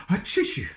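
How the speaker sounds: loud high achoo; sneeze. Acted. Cold Mister Sneeze answering the wizard with a sneeze. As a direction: sneeze it out big. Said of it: "replied Mister Sneeze".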